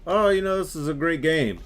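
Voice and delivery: chirpy fake praise of the game; sarcastic quote. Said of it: "And I'm like".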